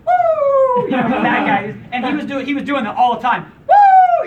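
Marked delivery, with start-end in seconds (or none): 0.1-0.8 high whooping woo; woo yell. Retold or imitated. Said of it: "the woo guy". 3.7-4.3 high whooping woo; woo yell. Retold or imitated. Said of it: "the woo guy".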